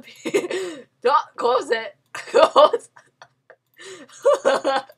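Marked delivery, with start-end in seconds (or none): none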